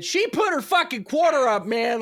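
excited egging-on quote; hyped quote. Retold or imitated. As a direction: pump them up, go for it. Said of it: "I think it's because I said".

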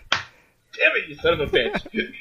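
high, strained alien voice; Martian voice. Pretend. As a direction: squeak it like the frail Martian. Answers in the Martian voice.

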